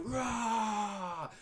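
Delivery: big high roar, shouted out open; dragon roar. Shown as a demonstration. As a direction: let it rip like a roaring dragon. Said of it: "let's do a dragon roar".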